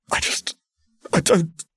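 high, broken stammer. Acted. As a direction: stumble over the words. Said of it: "Alun stammered".

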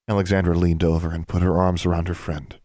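ordinary delivery, nothing marked

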